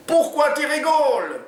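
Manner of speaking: very high, slow, loud Oumar voice; put-on yell. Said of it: "hurle Oumar à son tour".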